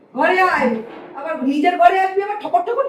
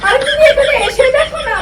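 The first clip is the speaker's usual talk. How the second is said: high, loud, exasperated; impatient shout; 8 semitones higher, 6 dB louder than usual. Acted. Mother-in-law orders daughter-in-law to come and look, impatient shout. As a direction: shout at someone to come over now.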